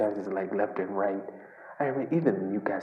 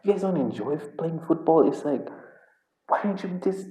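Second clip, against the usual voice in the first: higher-pitched incredulous plea to the players; exasperated disbelief; 7 semitones higher than usual. Retold or imitated. An angry fan pleading with his team, high and incredulous. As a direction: throw up your hands in disbelief, pitched up. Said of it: "And I'm like".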